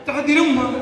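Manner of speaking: ordinary talk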